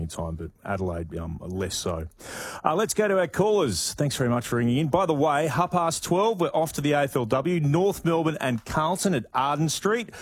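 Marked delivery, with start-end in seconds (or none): 2.6-10.1 high, upbeat radio link; radio host announcement. Real, not acted. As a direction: read out what's coming up in a raised host voice.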